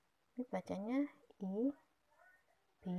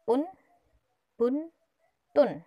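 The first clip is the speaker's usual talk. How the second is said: loud drill; 5 semitones higher, 11 dB louder, slower than usual. Shown as a demonstration. Teacher drilling syllables for children.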